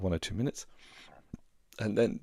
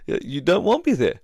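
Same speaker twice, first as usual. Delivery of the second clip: higher, far-off imitation of his own confused protest with a laugh; mock protest; 11 semitones higher, 4 dB louder than usual. Husband protests to wife he was told to leave, pitched-up baffled. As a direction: play yourself baffled, pitched up. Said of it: "I'm like".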